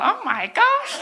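high-pitched scandalised gasp of words, played for laughs; mock shocked nan. Nan watching and finding out she was a stoner, mock shocked. Nan's imagined reaction to her secret.

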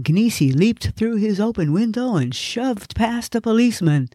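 ordinary delivery, nothing marked

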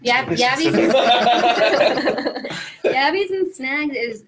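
can't get it out for laughing; laughing over the Aussie slang. Real, not acted. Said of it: "laughing through the phrase".

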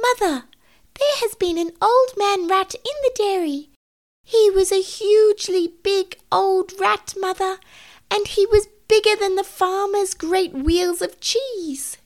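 high, indignant kitten voice; huffy kitten. Acted. Mittens reporting the butter thief to mother, huffy. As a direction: tell on the thief in a high-pitched huff. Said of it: "said Mittens in a huff".

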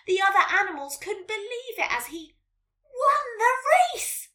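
loud, high, excited storyteller's yell; triumphant climax. A narrator's reading. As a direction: cheer the winner over the finish line. Climax of the story, snail wins.

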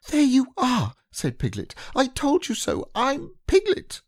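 vindicated at last; triumphant piglet. Acted.

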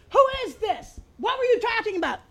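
high, sharp imitation of the roommate; roommate's challenge. Roommate picking up the phone, high suspicious demand. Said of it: "that same voice answered".

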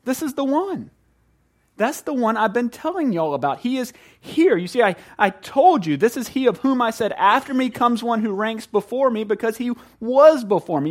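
high, thrilled voice; breathless, delighted introduction; excited announcement. Retold or imitated. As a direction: announce him like a friend who finally showed up. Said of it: "you're like".

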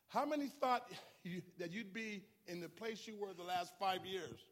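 loud, high, rising sermon climax; fiery pastor driving the sermon home; full-force preaching. Acted. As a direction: preach it hard to the congregation.

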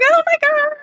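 very high-pitched, gushing retold reaction; starts sky-high, stays high with excitement; excited squeal. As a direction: squeal it like a thrilled fan. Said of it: "we're all excited".

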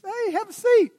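high, loud, hammed-up friendly call; playful invitation. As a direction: call out an over-eager prank invitation. Acts Jesus luring Peter onto rock.

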